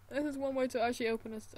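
usual speaking voice

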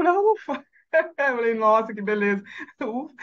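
high relieved exclamation with a laugh; laughing relief. Retold or imitated. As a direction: laugh with relief as you retell it. Retold relief after the cheers.

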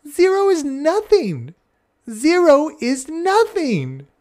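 high, loud, slow chanted cry; children shouting with joy; joyful shout. Retold or imitated. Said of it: "all shout in a loud voice".

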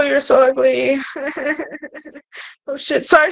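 ordinary talk, nothing marked

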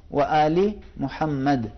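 "و آل محمد" recited slowly and clearly; slow careful recitation. Shown as a demonstration. Teacher reciting the blessing slowly as a model. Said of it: "تو اس وجہ سے کیا کرنا ہے".

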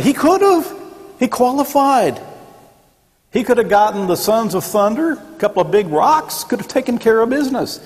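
rising fervor about what he could do; excited. Real, not acted. Preacher imagines Jesus using force.

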